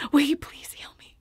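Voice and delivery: a soft, humble whisper to God; whispered prayer. Pretend. Praying humbly for healing, soft whisper. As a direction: ask it barely aloud.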